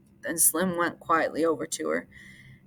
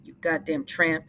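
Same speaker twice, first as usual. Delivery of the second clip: ordinary delivery, nothing marked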